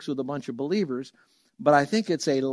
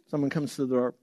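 ordinary talk, nothing marked

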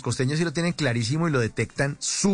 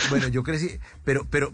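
usual speaking voice

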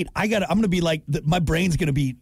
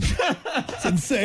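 slow, laughing repeat; 6 semitones higher, much slower than usual. Real, not acted. Host laughing at his own body joke. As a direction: laugh it out slowly.

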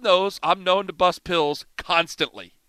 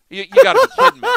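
higher and louder, laughing in disbelief; incredulous laugh; 8 dB louder, much faster than usual. Retold or imitated. Host told the coach wants him fired, laughing disbelief. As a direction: laugh it out, you can't believe it.

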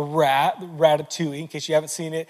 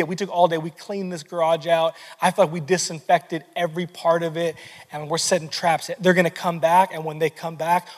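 usual speaking voice

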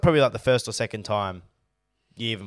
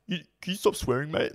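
mocking the fan; 4 semitones higher than usual. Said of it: "And then he's like".

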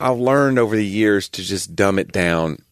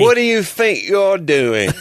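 high, slow, outraged British scold; angry British accent; 11 semitones higher, 4 dB louder, slower than usual. Retold or imitated. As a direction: bark it like an angry Brit. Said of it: "my favorite British accents".

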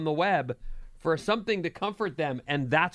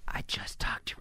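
whispered confession; quiet unvoiced whisper; 7 semitones lower, 8 dB quieter than usual. Mom whispering in story.

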